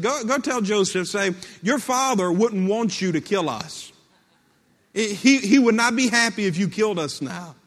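anxious message pressed on a go-between; urgent plea. Acted. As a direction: plead urgently, stumbling over the start. Brothers' fearful plea, acted in sermon.

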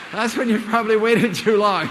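loud laugh through the words; laughing at his own punchline as he speaks. Real, not acted. Speaker to audience, laughing loudly after the joke.

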